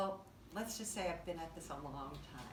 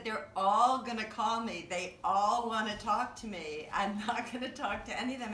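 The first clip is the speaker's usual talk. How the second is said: dismissive boast; 4 semitones higher, 8 dB louder than usual. Retold or imitated. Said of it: "and so I said".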